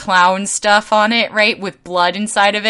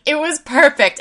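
higher, delighted grandma voice saying "It was perfect"; grandma's delight; 10 semitones higher, 5 dB louder than usual. Retold or imitated. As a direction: imitate a thrilled grandma, pitched up. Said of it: "And the grandma was like".

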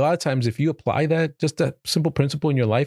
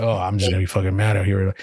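mock grumpy; 5 semitones lower than usual. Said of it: "sitting there and just grumpy".

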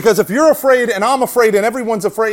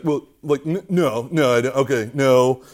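sheepish backtracking; 5 semitones lower than usual. Retold or imitated. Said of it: "He's like".

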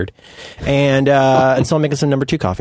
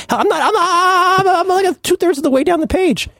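squeaky laughing aside; laughing high voice. Real, not acted. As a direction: lach het eruit, hoog en traag.

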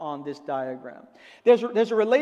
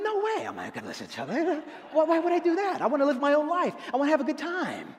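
mock carefree Jesus fan; 6 semitones higher, much faster than usual.

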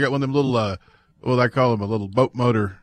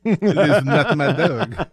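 mock French accent; 2 semitones higher, slower than usual. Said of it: "Rednecks doing French accents".

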